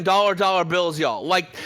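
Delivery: usual speaking voice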